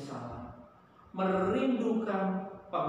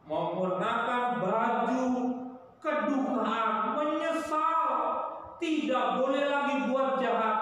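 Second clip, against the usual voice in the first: king's proclamation; 7 semitones higher than usual. Acted. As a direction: call the whole city to repent like a king. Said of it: "raja ini berkata".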